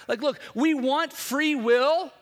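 fiery preacher hammering the congregation; preaching at full force. Real, not acted. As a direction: drive the point home from the pulpit.

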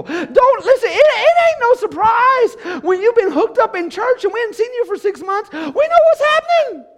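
very high, loud, impassioned climax; preaching at full force. Acted. Pastor promising the church, loud preaching. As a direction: cry it out to the church.

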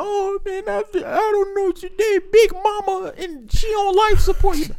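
high, mock-tearful whine; breaking down. Grown man breaking down to his friend, mock tearful. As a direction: play a grown man crumbling into tears. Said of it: "And then they break down".

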